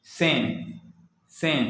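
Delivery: सें ending on a nasal ए; French nasal IN. Shown as a demonstration. Teacher modelling an example word twice, nasal ए in सें. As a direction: say सें through the nose, no hard N. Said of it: "ए जैसा होता है".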